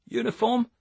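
high, dismayed disbelief; dismayed question. A narrator's reading. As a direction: ask it in disbelief. Tommy's dismayed thought.

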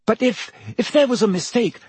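high, hurried plea; a frightened student's interruption; pleading girl. A narrator's reading. Hermione pleading with Snape.

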